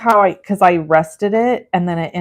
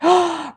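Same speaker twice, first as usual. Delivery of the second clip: sharp gasp; 10 semitones higher than usual. Real, not acted. Reacts to a friend's comment.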